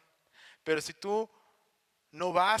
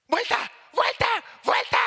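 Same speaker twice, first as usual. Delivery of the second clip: shrill panicked shouts, much higher and louder; frantic yell; 7 dB louder than usual. Retold or imitated. Mom yelling at her son to turn now, frantic shout. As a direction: bark the word like a terrified passenger.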